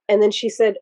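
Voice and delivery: usual speaking voice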